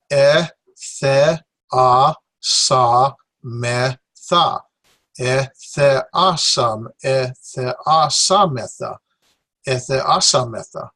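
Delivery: "E, the, a, sa, me, tha" split into separate syllables, repeated; syllable drill. Shown as a demonstration. Teacher drilling Greek syllables for students. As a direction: sound out "E, the, a, sa, me, tha" one syllable at a time. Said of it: "keep pronouncing it and practicing it in that manner".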